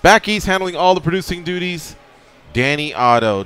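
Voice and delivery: high, loud show opener; radio host intro. Acted. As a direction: open the show like an announcer.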